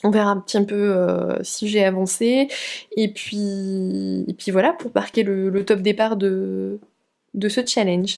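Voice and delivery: ordinary talk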